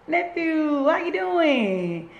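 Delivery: high, slow sing-out to a nephew; drawn-out greeting. Real, not acted. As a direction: call out fondly, stretching the words. Greets nephew joining the live.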